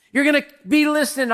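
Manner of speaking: ordinary talk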